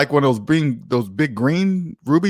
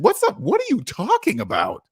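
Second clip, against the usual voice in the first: baffled; 7 semitones higher than usual. Real, not acted. Baffled reaction to comment.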